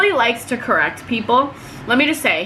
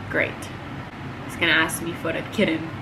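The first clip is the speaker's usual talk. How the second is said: weary sigh; 7 semitones lower, 7 dB quieter, faster than usual. Retold or imitated. Dreading the customer again.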